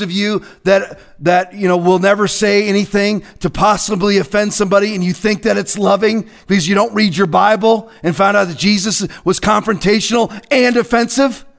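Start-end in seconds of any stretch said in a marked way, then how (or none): none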